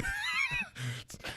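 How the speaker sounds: high burst of laughter; laughing. Real, not acted. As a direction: cackle at the joke. Laughing at stolen phone.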